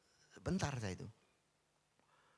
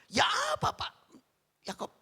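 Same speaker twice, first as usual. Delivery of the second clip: much higher, louder anguished shout; grieving complaint; 8 semitones higher, 10 dB louder than usual. Retold or imitated. Grieving father shouting his complaint to the pastor, anguished. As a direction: cry out at God in bitter protest. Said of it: "Ngomel, cerita saya dia".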